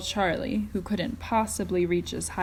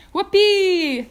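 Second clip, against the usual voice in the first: high, loud gleeful whoop; shouted whoopee; 11 semitones higher, 14 dB louder than usual. A narrator's reading. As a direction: yell it out with glee. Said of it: "shouted Mike T V".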